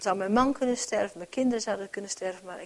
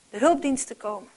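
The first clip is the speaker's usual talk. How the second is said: normal talking voice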